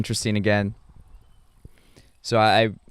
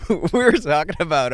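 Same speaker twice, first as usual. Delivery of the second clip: higher, breaking into laughter on the words; laughing; 6 semitones higher, 4 dB louder than usual. Real, not acted. Telling what he and friends discussed, laughing. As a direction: talk through a laugh. Laughs while restarting his story.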